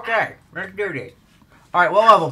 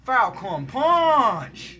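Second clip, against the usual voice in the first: slow drawn-out Falcon Punch battle cry; Falcon Punch yell; 2 semitones higher, much slower than usual. Pretend. Launching his rider with a drawn-out Falcon Punch battle cry. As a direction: stretch every syllable like a fighting-game hero. Repeats the catchphrase.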